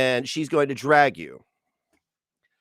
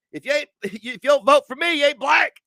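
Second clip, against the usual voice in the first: old politician impression; 12 semitones higher, 5 dB louder than usual. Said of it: "Old corn pop".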